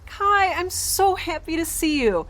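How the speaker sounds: high, gushing joy; overjoyed. Acted. Greeting the repaired robot Kai, high and gushing. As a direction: gush with delight.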